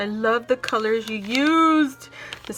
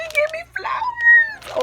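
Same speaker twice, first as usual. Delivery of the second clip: much higher, louder thrilled shout; excited shout; 7 dB louder than usual. Real, not acted. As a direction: shout it out with joy.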